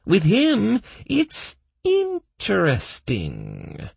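very high, drawn-out elf voice; high-pitched, dreamy glee; happy elf. A narrator's reading. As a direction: stretch the last word with delight. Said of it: "happily smiled the hairless elf".

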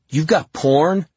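high, loud incredulous question; eager, astonished question; excited disbelief. A narrator's reading. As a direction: ask in amazement, pitch shooting up. Amazed question about the secret.

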